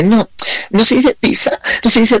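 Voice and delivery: a high, preachy know-it-all voice; mock corrector's pedantic whine. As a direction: mimic a fussy grammar corrector. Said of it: "si alguien me corrige diciéndome".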